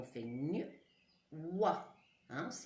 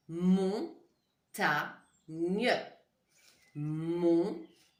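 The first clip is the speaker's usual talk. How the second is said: montagne split as mon, ta, gne with clear gaps; firm, separated beats in "montagne", for children writing; syllable by syllable; 6 dB louder, much slower than usual. Shown as a demonstration. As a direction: dictate "montagne" one loud syllable at a time. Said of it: "On commence par écrire la première syllabe".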